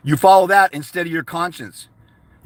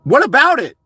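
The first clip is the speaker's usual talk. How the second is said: normal talking voice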